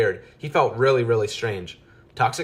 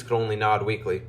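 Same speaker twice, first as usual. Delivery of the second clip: ordinary delivery, nothing marked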